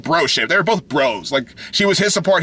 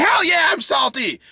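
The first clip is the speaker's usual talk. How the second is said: higher, shouted and fed up; pissed-off shout; 6 semitones higher than usual. Real, not acted. Ranting at fans about the Bleach ending, furious shout. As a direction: yell it out, truly salty. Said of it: "I'm just so pissed off right now".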